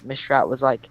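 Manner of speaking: ordinary talk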